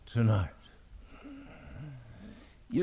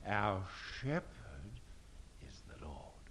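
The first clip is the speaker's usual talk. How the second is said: fading to a whisper; 7 semitones lower, 15 dB quieter than usual. Real, not acted. Climax of preaching point.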